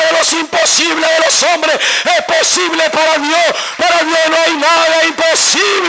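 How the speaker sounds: forceful shouted exhortation; full-force preaching. Real, not acted. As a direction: drive each promise home to the congregation, building to a shout.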